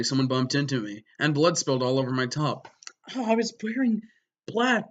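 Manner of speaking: normal talking voice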